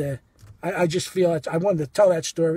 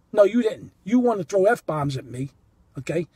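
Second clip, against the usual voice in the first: usual speaking voice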